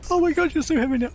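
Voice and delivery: strained panicked yelp. Real, not acted. Stuck in game, straining.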